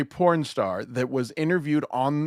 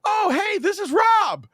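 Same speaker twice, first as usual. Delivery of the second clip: mocking ditzy girl; 3 dB louder than usual. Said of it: "And she's like".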